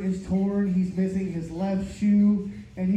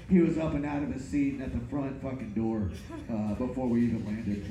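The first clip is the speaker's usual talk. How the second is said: ordinary talk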